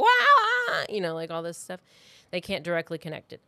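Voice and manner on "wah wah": high, loud mocking wail with a laugh in it. Podcaster mimics the killer's incoherent phone calls, high mock wail. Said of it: "it's like".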